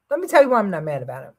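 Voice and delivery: fast, tumbling "Let me tell you why I'm not mad about it"; quick throwaway delivery; rushed explanation. Real, not acted. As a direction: hurry through the whole line. Quick follow-up explaining himself.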